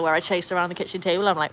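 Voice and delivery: ordinary delivery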